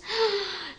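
big storyteller's gasp of wonder; amazed gasp. Acted. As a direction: gasp as if seeing for the first time. Acts Bartimeu's amazement on seeing.

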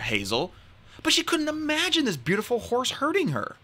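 usual speaking voice

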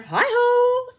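high, loud cheery call. Acted. Hare arriving at the lair, calls out cheerily. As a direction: sing out hi-ho from the door.

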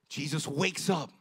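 ordinary talk

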